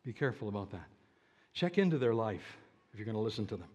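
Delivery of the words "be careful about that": much lower, softer caution; soft warning. Shown as a demonstration. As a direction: drop the voice to warn gently. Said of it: "repeated warning".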